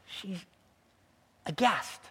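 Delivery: ordinary talk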